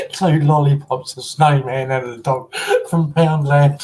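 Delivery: real giggles creeping into the sentence; tickled. Real, not acted. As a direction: laugh your way through the words. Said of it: "that really did tickle me".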